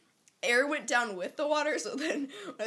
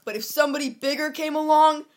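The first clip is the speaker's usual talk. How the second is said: loud dramatic poem recital; dramatic poem reading; 9 dB louder than usual. Acted. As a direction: declaim it like a wounded teen. Reads poem aloud.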